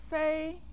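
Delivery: Fei held high and level; first tone. Shown as a demonstration. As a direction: sustain Fei on a steady high pitch. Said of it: "the first tone".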